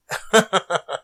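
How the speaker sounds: sudden high-pitched laugh; a quick, high, real laugh. Real, not acted. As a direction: burst out laughing at yourself. Laughing at own spooky reaction.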